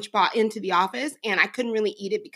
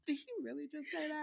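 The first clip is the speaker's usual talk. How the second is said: gasping through laughter, much softer; laughing disbelief; 4 semitones higher, 20 dB quieter, faster than usual. Real, not acted. Mom laughing retelling son's comeback, breathless giggles. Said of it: "I said".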